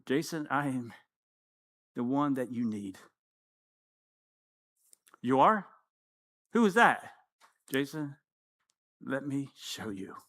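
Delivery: low, soft, tender divine voice; gentle voice of God. Retold or imitated. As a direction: speak softly and lovingly to Jason. God speaking to him.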